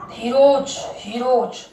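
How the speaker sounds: "हीरोज" with a firm j-like ending; final J sound. Shown as a demonstration. Teacher giving the next example, final J sound. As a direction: say "हीरोज" as hee-roj. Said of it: "हीरोज नहीं बोलते".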